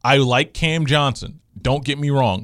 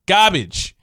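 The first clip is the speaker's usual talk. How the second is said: Garbage said as "gahbidge", dropped r, louder; New York accent; 4 semitones higher, 4 dB louder than usual. Shown as a demonstration. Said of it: "in my New York accent".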